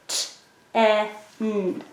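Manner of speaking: "T, e, n" as three isolated sounds: t, short eh, nnn; "T, e, n" as separate sounds with pauses, not letter names. Shown as a demonstration. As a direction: stretch out "T, e, n" one phoneme at a time. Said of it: "I'm going to finger stretch it".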